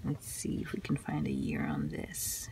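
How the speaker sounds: soft whisper to herself; whispered search. Real, not acted. Hunting for a year on a fragile page, whispering. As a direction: mutter it under your breath.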